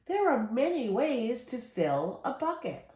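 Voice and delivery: ordinary delivery, nothing marked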